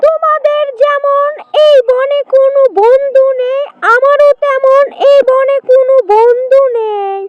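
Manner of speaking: high, friendly animal-character voice; lightly voiced sly jackal.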